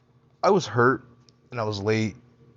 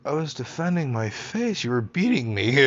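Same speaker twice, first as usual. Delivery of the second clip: defensive protest; 4 semitones higher than usual. Retold or imitated. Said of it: "I was like".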